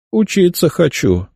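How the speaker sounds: crooked, wry half-smile voice; wry smirk. A narrator's reading. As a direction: say it with a wry grin. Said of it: "криво усмехнулся я".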